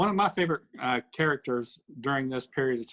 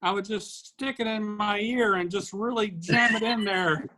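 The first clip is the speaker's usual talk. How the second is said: Willie and Frankie voice; 6 semitones higher than usual. Retold or imitated. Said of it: "they were like".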